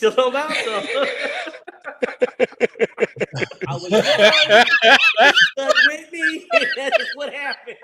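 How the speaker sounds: can't stop laughing at the memory; real laughter breaking into each phrase. Real, not acted. Cracking up at own story.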